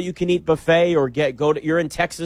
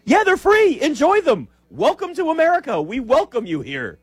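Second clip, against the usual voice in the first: much higher, bright cheering welcome; excited welcome; 11 semitones higher than usual. Real, not acted. Welcoming foreign fans to America, much higher and excited. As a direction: throw your arms open to the visitors.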